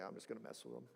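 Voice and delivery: near-silent, playful hush of a mischievous Jesus; mock sneaky aside. Preacher imagining a sleeping Jesus, hushed and teasing. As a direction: toss it off under the breath as a joke.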